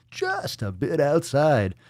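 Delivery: Bob Uecker. Acted. Host quotes baseball movie commentator, drawn-out announcer call. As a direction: stretch the first word like a play-by-play announcer. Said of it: "Little Bob Uecker".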